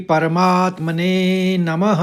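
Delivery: slow, reverent opening chant; slow invocation. Acted. Teacher opening the Gita lesson with a slow invocation. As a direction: intone the invocation slowly.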